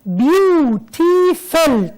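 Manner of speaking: "beautiful" very slowly, in three beats: beau, ti, ful; split into syllables. Shown as a demonstration. Teacher counts three syllables, drawing out "beautiful" piece by piece. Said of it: "تلاتة".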